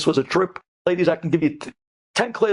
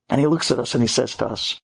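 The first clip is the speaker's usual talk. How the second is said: ordinary delivery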